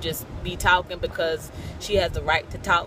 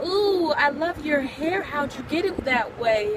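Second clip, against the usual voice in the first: admiring lady; 7 semitones higher than usual. Retold or imitated. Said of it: "The lady was like".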